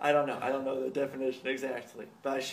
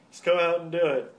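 loud, bossy and higher; pushy mock order; 5 semitones higher, 9 dB louder than usual. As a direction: bark it to hurry him. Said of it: "He's like".

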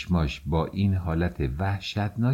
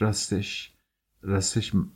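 usual speaking voice

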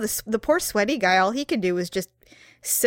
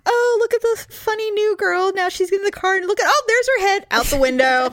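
mock coworkers mocking; 9 semitones higher than usual. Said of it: "They're probably like".

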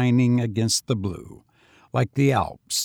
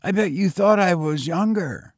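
higher, youthful lad's voice; street boy; 7 semitones higher than usual. A narrator's reading. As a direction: play the lonely young tramp, higher. Boy's voice.

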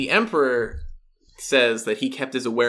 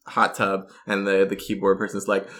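ordinary delivery

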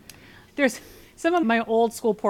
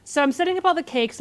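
ordinary talk

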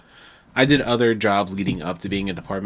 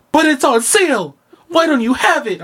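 high, loud mock whine; whiny customer; 5 dB louder than usual. Customer demanding the sold-out item, high loud mock whine. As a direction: complain like an entitled shopper. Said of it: "Now they're like".

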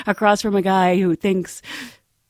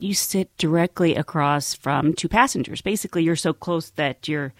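normal talking voice

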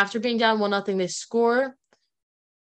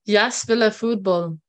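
slow, careful Danish; a hint of a Danish accent; bad Danish accent. Shown as a demonstration. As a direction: say "Jeg spiller fodbold" slowly in a learner's Danish accent. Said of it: "my bad accents".

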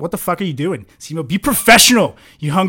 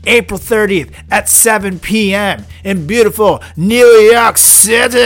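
drawn out, louder hype read; promo announcer; 3 semitones higher, 6 dB louder, slower than usual. Acted. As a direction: sell the show like an ad.

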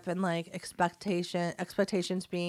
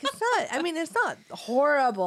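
defensive mom; 6 semitones higher than usual. Retold or imitated. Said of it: "And I'm like".

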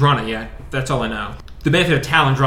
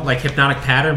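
usual speaking voice

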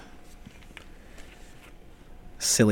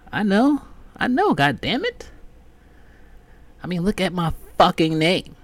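exasperated; 10 semitones higher than usual. Real, not acted. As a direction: throw up your hands. Exasperated admission.

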